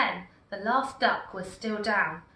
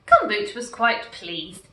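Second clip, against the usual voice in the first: plain reading voice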